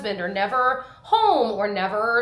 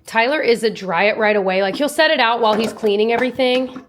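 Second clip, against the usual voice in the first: ordinary talk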